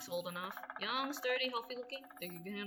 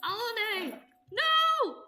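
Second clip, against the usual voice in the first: high, loud call of a name, then scream; anguished cry; 10 semitones higher, 9 dB louder, much slower than usual. Acted. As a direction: cry out as she's dragged away. Crying out for someone taken.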